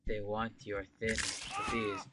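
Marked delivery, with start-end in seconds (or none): 1.7-2.1 squeaky bees. Real, not acted. Alarm at bees.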